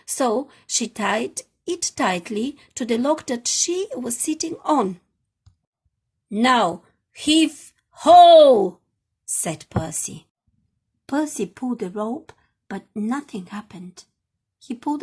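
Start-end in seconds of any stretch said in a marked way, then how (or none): 6.3-8.8 loud effortful haul call; heave ho. Acted. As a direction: bellow it as you pull. Hauling the rope.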